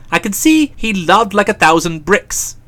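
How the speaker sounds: ordinary delivery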